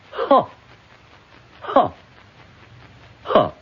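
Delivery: three hard, hollow barks of laughter; mock laugh that dies away; forced mirthless ha ha ha. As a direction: laugh on command, bitterly.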